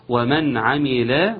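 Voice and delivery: ومن عمل slowly with a clear nun. Shown as a demonstration. Teacher showing izhar, slow. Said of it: "النون اتى بعدها حرف العين".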